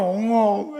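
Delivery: hammed-up sobbing sound; mock boo-hoo sob. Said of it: "애가 엉엉 울어". (아이 우는 소리 흉내)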